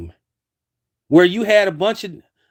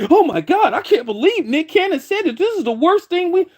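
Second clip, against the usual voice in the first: high mock-shocked voice; mocking outrage; 10 semitones higher than usual. Mocking how offended people sounded, in a high mock-shocked voice. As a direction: ham up the gasping outrage, pitched high.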